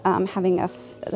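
ordinary delivery

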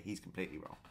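ordinary talk

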